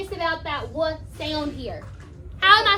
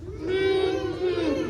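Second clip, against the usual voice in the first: closed-mouth hum, one note per syllable; two-beat teaching hum; hummed syllables; 4 dB quieter than usual. Shown as a demonstration. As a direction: hum the word's two beats. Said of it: "Hum it".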